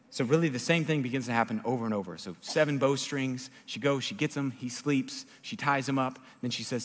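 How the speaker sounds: ordinary talk, nothing marked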